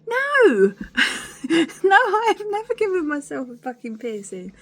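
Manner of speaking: high, loud and laughing no; high, loud scoffing denial; indignant denial. Real, not acted. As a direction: shoot down the question, half laughing. Indignant denial to friend's question.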